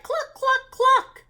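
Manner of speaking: high, loud hen clucks; clucking hens. A narrator's reading. Storyteller clucks as the hens to the children. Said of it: "the hens cried".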